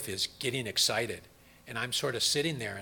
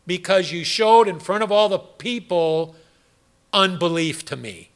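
voice of God; 3 semitones higher, 7 dB louder than usual. Retold or imitated. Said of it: "He said".

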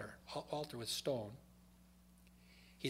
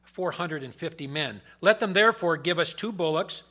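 loud, higher booming scripture reading; preaching scripture; 5 semitones higher, 10 dB louder than usual. Acted. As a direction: proclaim it from the pulpit. Reads scripture at full force.

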